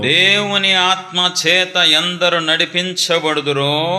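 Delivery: intoned sermon scripture; scripture recitation. Acted. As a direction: proclaim the verse slowly, stretching each word.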